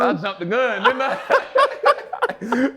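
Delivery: high, laughing self-mockery; real amused laughter at oneself. Real, not acted. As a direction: laugh through the words, pitch climbing. Laughing at his own rash choice.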